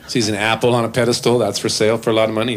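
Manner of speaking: ordinary delivery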